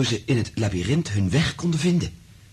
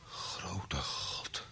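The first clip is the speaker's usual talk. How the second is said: awed whisper; 3 semitones lower, 15 dB quieter than usual. Acted. Frank stunned by mind-reading proof.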